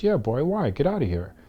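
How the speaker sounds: high-pitched dismissive sister, dropping at the end; imitating sister. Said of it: "She goes".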